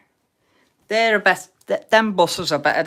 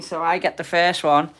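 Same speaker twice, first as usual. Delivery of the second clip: usual speaking voice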